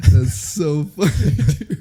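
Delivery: slow, giggly; laughing. Real, not acted. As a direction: talk through the giggles. Still laughing.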